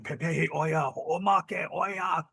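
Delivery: drawn-out mock war cry without volume; retold yelling. Brother heard Hawaiian guys in the street, retold yelling. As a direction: imitate warriors' yells, held back and stretched. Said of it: "Hawaiian guys just yelling".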